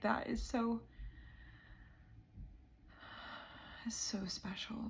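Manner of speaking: overwhelmed, barely voicing it; choked-up whisper. Real, not acted. Vlogger moved to tears by her own journey, whispering. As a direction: voice dropping to a whisper from emotion. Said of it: "I'm getting emotional".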